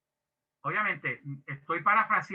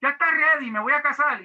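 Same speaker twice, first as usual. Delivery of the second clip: high, loud, impatient rush to announce; desperate eager groom; 7 semitones higher, 7 dB louder than usual. Retold or imitated. Young groom begging his father to let him marry, high and eager. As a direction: blurt it out like a groom who can't wait. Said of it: "el joven desesperado decía".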